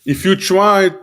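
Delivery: talking with gum in mouth; words around a wad of gum; chewing gum. Real, not acted. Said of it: "I have, like, gum in my mouth".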